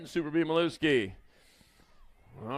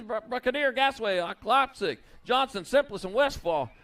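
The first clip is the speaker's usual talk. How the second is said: normal talking voice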